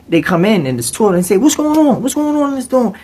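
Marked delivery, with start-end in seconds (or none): 1.4-2.9 high, demanding; mock officer. Officers bursting into the dorm, high mock voice. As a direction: demand answers.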